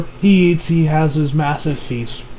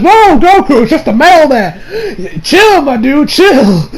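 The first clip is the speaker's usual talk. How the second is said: higher, louder, laughing through it; laughing chill; 7 semitones higher, 4 dB louder, slower than usual. Retold or imitated. Vlogger laughing at Goku over the mailman. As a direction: tell him to chill while laughing. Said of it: "I'm like".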